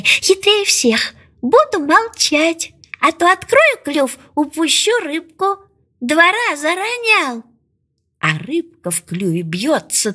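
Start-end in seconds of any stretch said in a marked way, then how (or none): 0.0-7.4 lightly voiced crafty duckling, high pitched; high little voice, pleased with its own plan; self-satisfied duckling. A narrator's reading. Duckling plots to keep its beak shut, lightly smug. Duckling thinks itself very cunning.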